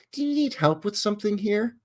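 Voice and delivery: high, falsely helpful question; a suspicious lady politely shooing a filmer away; polite Karen. Retold or imitated. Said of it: "politely telling me to get lost".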